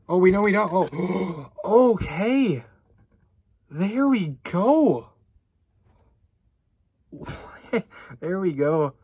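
last words drawn out slowly in real glee; excited surprise. Real, not acted. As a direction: react to a big pull you didn't expect. Surprise big pull in pack.

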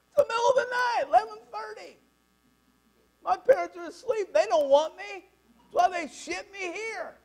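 put-on high, loud Italian-accented scolding; worked-up Italian tirade; Italian accent rant. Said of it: "That's Italian".

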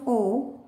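final "O" said aw, as starts orange; short O sound. Shown as a demonstration. Teacher explains short O to learners, "O" said aw. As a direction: end on "O" sounded as aw, not oh. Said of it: "The short sound of O".

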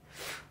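“f” as soft voiceless friction, no vowel; isolated f sound. Shown as a demonstration. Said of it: "上牙轻咬下嘴唇". (上牙轻咬下唇，只用气流发“f”)

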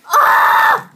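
sudden high disgusted shriek. Real, not acted. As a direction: shriek at the awful taste. Reacting to a gross bean.

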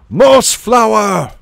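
long, high, loud battle cry; ringing roar. A narrator's reading. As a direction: roar it out across the waves. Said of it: "roared out in a voice that rang across the waves".

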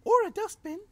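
tiny squeaky badger explaining; little badger voice. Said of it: "Stuart the Badger explained".

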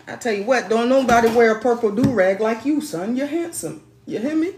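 loud, high praise for her son; proud hype. Real, not acted. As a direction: pump him up with pride. Hyping up son's do-rag.

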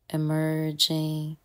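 normal talking voice

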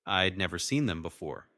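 usual speaking voice

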